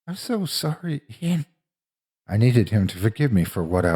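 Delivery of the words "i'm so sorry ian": high, soft, pained apology; remorseful apology. A narrator's reading. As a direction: apologize softly in a raised pitch. Tearful apology after shocking friend.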